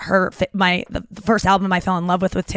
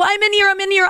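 high retold call; hammed-up high call; warning a stranger she's in the stall, high mock call; 11 semitones higher than usual. Calling out from the stall.

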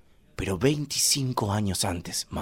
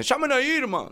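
calling for Irma; slower than usual. Retold or imitated. Familia llama a la mamá.

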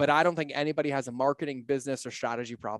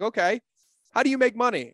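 higher, louder retold questioning; pointed mock quizzing, pitched up; challenging wife; 8 semitones higher, 5 dB louder than usual. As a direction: imitate a wife pressing for the obvious answer. Wife challenges him in retold argument.

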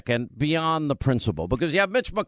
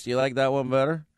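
normal talking voice

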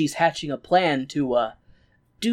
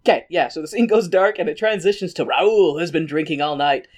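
usual speaking voice

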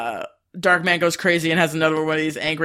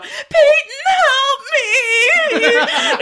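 very high, loud damsel cry; mock yell for help; 4 dB louder, much slower than usual. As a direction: scream it like a hammed-up damsel in distress. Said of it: "yells out".

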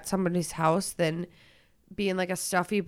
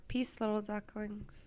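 soft sign-off; 16 dB quieter than usual. Pretend. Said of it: "Why don't you just be like".

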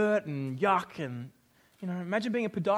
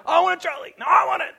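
put-on loud, high, whiny kid voices; mock the kids fighting over trolleys; squabbling kids; 9 semitones higher, 8 dB louder, faster than usual. Kids fighting over Bunnings trolleys, high loud whine. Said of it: "they're all like".

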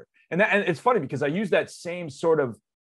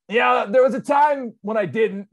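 loud, high burst of relief; relieved shout; 9 semitones higher, 5 dB louder than usual. Retold or imitated. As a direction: call it out, half laughing with relief. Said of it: "And I was like".

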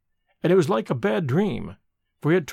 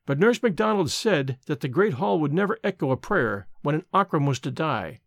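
ordinary delivery

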